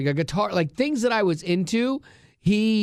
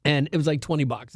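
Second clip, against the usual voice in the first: ordinary talk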